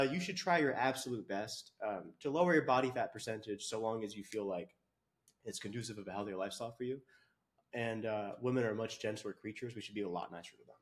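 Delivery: low, soft, careful voice; gentle backing off. Real, not acted. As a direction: drop right down and go easy. Goes soft on women after rant.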